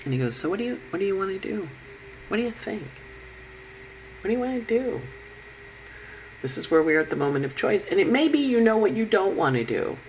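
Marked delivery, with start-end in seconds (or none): 0.4-5.0 soft, higher-pitched coaxing question; hushed prompting, raised pitch; leaning-in question. Acted. Mercury leans forward and looks at you, soft high prompting. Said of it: "he kinda leans forward, and he looks at you".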